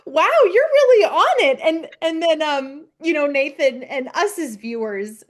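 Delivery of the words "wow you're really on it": higher, louder, bright praise; impressed praise. Retold or imitated. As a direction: gush over her daughter's idea. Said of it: "she's like".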